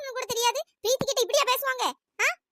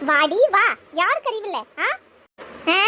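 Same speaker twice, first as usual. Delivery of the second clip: loud taunting call; come-here taunt, shouted; angry challenge; 6 dB louder, slower than usual. Acted. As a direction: shout the dare across the room. Aunt challenges the niece.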